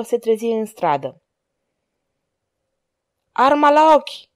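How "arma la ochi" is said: high, loud order to the ranks; military command. A narrator's reading. Monclar ordering the arquebusiers to aim, commanding. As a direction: call it out like a drill order. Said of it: "comandă Monclar".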